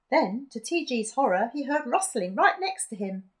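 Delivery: ordinary delivery, nothing marked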